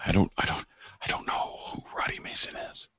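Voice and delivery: stammering, tearful whisper; terrified whisper. Acted. Pinned man telling his attacker he knows nothing, terrified whisper. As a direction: whisper it haltingly, scared for your life.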